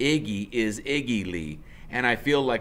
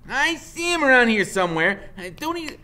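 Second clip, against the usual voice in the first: much higher, louder insistent needling; pushy woman; 8 dB louder than usual. Acted. Woman needling her man about the kid, high and loud. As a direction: badger him with each huh.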